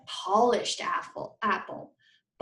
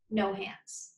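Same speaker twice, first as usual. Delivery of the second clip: ordinary delivery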